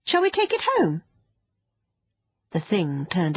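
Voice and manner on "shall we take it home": put-on very high, hopeful child's question; eager girl. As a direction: pipe up like an eager little girl. Child eager to keep creature.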